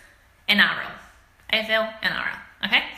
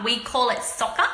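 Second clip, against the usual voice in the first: ordinary delivery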